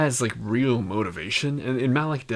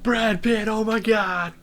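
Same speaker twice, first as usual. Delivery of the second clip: excited; 10 semitones higher, 4 dB louder, slower than usual. Pretend. Mock excited star reaction.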